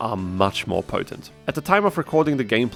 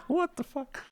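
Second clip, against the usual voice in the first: high, soft voice through laughter; real amused bewilderment, pitched high; laughing disbelief; 7 dB quieter than usual. Real, not acted. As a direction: squeak it out while laughing. Cracks up at own script typo.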